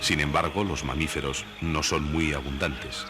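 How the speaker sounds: ordinary delivery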